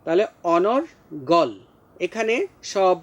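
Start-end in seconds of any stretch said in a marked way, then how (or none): 0.4-1.6 অনর্গল split as onor, pause, gawl; slow pronunciation demo. Shown as a demonstration. Teacher breaks down the word, slow careful articulation. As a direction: sound out অনর্গল slowly with a clear r. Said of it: "রেফের জন্য র".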